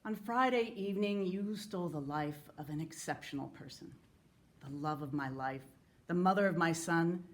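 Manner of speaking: measured, sincere public reading of a letter; earnest letter reading. Acted. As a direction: read it with restrained grief and defiance. Reads a widower's letter to killers.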